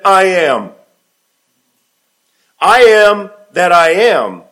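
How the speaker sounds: slow, loud, lifted proclamation of I am; solemn declaration. Acted. Preacher voicing Jesus to the council, slow booming proclamation. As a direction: ring it out like a divine name.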